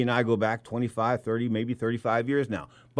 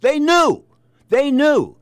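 emphatic shout; 14 semitones higher, 9 dB louder, much slower than usual. Real, not acted. Host ranting about cheating corner.

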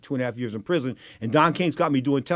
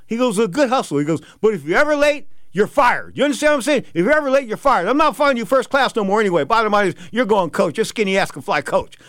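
higher, louder hammed-up boss lecture; boss scolding; 7 semitones higher, 5 dB louder than usual. Don King scolds his employee, loud mock boss voice. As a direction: lay down the law as the boss. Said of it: "He goes, he goes, he goes".

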